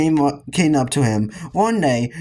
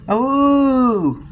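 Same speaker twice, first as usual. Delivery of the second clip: high wailing wolf howl; hammed-up werewolf howl; 9 semitones higher, 3 dB louder than usual. A narrator's reading. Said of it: "howled the werewolf".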